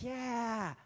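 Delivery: high, drawn-out cheer; excited onlooker's high "yeah"; cheering crowd. Acted. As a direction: cheer on a daredevil. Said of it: "They're cheering him on".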